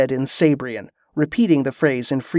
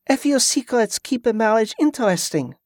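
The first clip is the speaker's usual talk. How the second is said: lightly raised, teasing wife; teasing wink; 7 semitones higher than usual. A narrator's reading. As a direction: tease your husband with a wink. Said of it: "she said with a wink".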